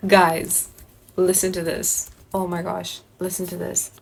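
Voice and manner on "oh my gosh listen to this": normal talking voice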